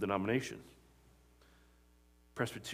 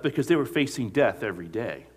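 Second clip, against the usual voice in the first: usual speaking voice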